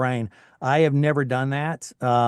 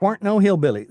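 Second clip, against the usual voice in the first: higher, louder mock Tennessee twang; Southern hillbilly; 6 semitones higher, 4 dB louder, faster than usual. Guest correcting himself in thick Southern twang. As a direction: ham up the Southern twang, grinning. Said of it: "as Southern as Southern could be".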